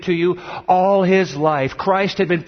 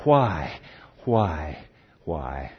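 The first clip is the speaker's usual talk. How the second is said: each "why" lower and softer, fading; a parent's moan dying away; lightly voiced grieving parent, sinking to a near murmur; 13 semitones lower, 5 dB quieter, much slower than usual. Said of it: "and moaned".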